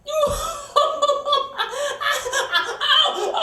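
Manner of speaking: high wheezing cackle of a laugh; imitated laugh. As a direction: burst into her big high laugh. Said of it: "making her laugh went like this".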